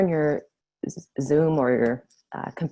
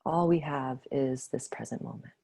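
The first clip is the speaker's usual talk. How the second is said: guided meditation. Acted. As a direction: ease them gently back to the room. Leads closing of meditation.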